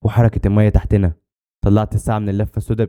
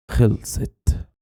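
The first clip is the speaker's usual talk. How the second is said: dying man's faint, broken gasp; broken word; 2 semitones higher, 4 dB quieter, much slower than usual. A narrator's reading. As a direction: barely voice it, in fragments. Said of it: "وقال كلمة متقطعة".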